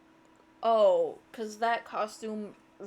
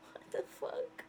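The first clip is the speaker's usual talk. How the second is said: whispered in horror; real creeped-out whisper; 10 dB quieter than usual. Real, not acted. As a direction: breathe it out, appalled. Creeped out by character.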